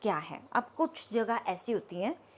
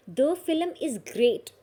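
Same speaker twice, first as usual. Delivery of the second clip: slow demo; 4 semitones higher, 4 dB louder, much slower than usual. Shown as a demonstration. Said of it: "teacher reads example slowly".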